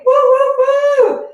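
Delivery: high, loud whooping cheer; excited cheering whoops. Real, not acted. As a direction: whoop it up like a hype coach. Cheering on workout viewers.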